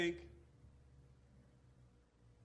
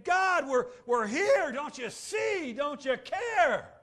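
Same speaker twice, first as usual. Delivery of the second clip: loud, high, slow pleading cry; desperate cry to God; 7 semitones higher, 8 dB louder, slower than usual. Retold or imitated. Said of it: "crying out to God saying".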